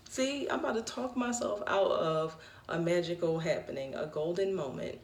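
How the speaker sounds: normal talking voice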